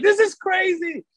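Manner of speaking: put-on high, stretched-out kid's squeal of amazement; eight-year-old inner child. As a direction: let your inner kid marvel, drawn out and way up high. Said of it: "eight-year-old in me that'd be like".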